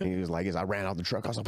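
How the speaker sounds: ordinary talk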